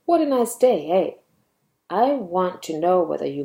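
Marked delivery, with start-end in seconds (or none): 0.0-1.1 high start on WHAT, lilting into "eh"; exclamation with "eh". Shown as a demonstration. Teacher exclaims to see if you agree, exclamation with rising "eh". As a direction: exclaim it higher, then tag on a rising EH. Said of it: "And when I exclaim".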